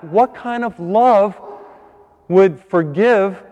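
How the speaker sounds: slow emphatic preaching. Acted. Preacher's rhetorical climax.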